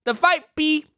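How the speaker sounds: hammed-up accented uncle; Uncle Roger. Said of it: "coax Uncle Roger out".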